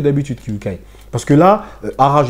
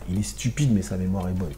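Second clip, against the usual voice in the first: much softer, lower musing repeat; quiet repeat; 9 semitones lower, 9 dB quieter than usual. Real, not acted. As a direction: murmur it back to himself.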